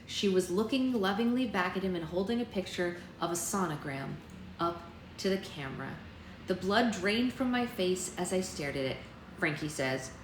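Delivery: usual speaking voice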